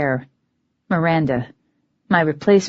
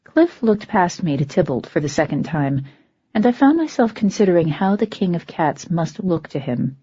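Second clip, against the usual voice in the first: plain reading voice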